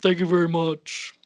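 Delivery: lightly voiced rock-and-roll idol; rough stage impression. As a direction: try the King's famous thank-you. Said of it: "my best [name] impression".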